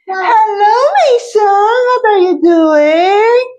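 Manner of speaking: high playful hello to the son, slowed on the question; sweet mom voice. Acted. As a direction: coo at your son like a doting mother. Calling sweetly to her son.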